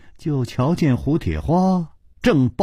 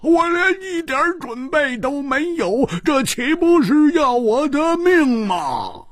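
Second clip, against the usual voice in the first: much higher, slow, drawn-out wail to heaven; crying out; slower than usual. Acted. Hu Tiehua falling on the bed in despair, drawn-out cry. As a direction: fling yourself down and cry to the sky. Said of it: "大呼道".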